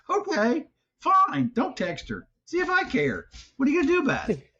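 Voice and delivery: high, huffy sulk; mock pouting voice; petulant machine. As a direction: play a sulky gadget throwing a fit. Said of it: "sulking phone voice".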